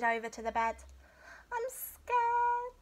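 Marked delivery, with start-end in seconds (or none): 1.5-2.7 high small voice of a scared cub; high-pitched timid bear cub; little bear cub. A narrator's reading.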